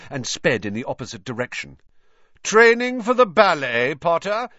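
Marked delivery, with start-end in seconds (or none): 2.4-4.5 high, loud, sneering taunt; mocking yell. A narrator's reading. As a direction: jeer across the pitch to humiliate. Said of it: "yelled Malfoy".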